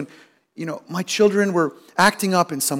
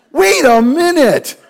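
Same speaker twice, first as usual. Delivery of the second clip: higher, louder, slow mock outrage; indignant inner thought; 8 semitones higher, 7 dB louder, slower than usual. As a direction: huff at the wife in mock offence. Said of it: "What I'm thinking is".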